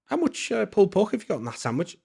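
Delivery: high-pitched, loud incredulous asking; incredulous question. Retold or imitated. Manager quizzing the chef about portion size, incredulous and high. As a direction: sound amazed at how much is in it. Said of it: "I said".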